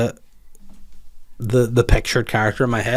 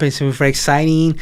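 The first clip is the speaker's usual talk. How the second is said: put-on light Filipino accent, lilting; a hint of a Filipino accent; 4 semitones higher, 3 dB louder than usual. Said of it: "speaking like a Filipino there".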